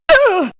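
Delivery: high, strangled cry of pain; a short, high, hurt cry; pained grunt. Acted. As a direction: gasp out as if struck. Dying or hit grunt.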